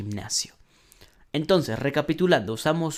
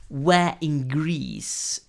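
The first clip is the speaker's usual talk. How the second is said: slow model reading; much slower than usual. Shown as a demonstration. Teacher models English sentence.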